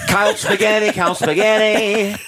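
put-on high Australian kids' entertainer chant. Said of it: "The Angry Wiggles".

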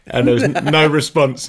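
ordinary delivery

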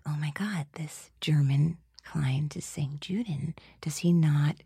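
soft, near-whispered retold thought; hushed disbelief. Voice actor appalled at the German client, hushed disbelief. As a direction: drop right down as if he might overhear. Stunned private thought in booth.

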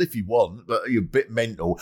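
ordinary delivery

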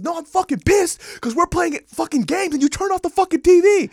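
loud, high angry rant; furious yell. Retold or imitated. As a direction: yell in fury about the game. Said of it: "He goes".

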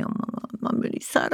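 put-on hushed sad child; quiet and sad. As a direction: breathe it out like a sad little girl. Daughter's sad words retold.

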